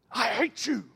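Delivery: a far, cold "I hate you"; voicing someone lashing out; hateful quote. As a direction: spit it out like you mean it.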